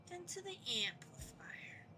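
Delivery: whispered, questioning echo; puzzled whisper. Pretend. Player puzzles over how to use the amplifier, whispering. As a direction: drop to a whisper mid-question.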